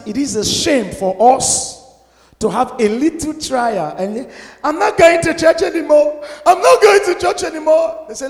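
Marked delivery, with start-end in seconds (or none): 4.6-6.2 put-on high, childish whine; sulky quitter voice, higher; mock sulky complaint. Mocking a sulking believer. 6.5-8.1 even higher whining repeat; hammed-up sulking whine; mock sulky complaint, higher. Mocking a sulking believer.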